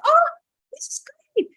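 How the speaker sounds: very high, airy gasp of delight; acted breathy high enthusiasm; delighted coach. Imitating coach's delighted reaction.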